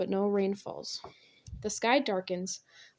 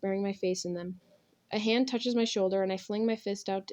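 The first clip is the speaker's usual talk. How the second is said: ordinary delivery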